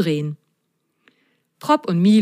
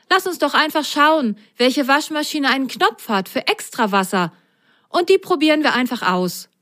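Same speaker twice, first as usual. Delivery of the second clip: higher-pitched, eager character voice; pitched up and can-do; plucky sock Milo; 7 semitones higher than usual. Acted. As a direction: propose a plan with breezy enthusiasm. Milo makes a suggestion.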